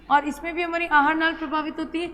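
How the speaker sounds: usual speaking voice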